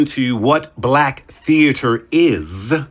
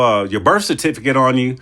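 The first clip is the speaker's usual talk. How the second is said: usual speaking voice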